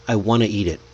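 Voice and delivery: "I want to eat it" run together as "I wanna eat it"; smoothly linked. Shown as a demonstration. Teacher shows natural linking, smooth and connected. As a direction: let the words flow into one another. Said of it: "smoothly so you don't sound robotic".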